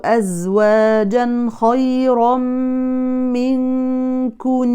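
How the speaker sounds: slow, drawn-out chanted recitation; Quran recitation. Acted. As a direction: recite the verse in measured tarteel. Recites the verse.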